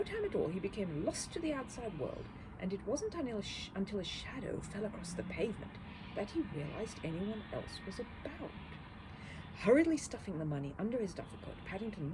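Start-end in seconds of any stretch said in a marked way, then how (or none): none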